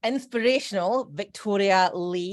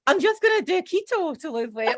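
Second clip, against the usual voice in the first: lightly mocking voice, pitched up; mock dieter; 10 semitones higher than usual. Host mocking people who say they do keto, mock voice. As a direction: parrot the annoying dieters lightly. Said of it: "when I hear people saying".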